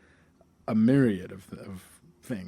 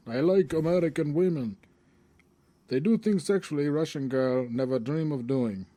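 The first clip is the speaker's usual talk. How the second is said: exaggerated Russian accent, higher; 4 semitones higher than usual. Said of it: "in this Russian accent".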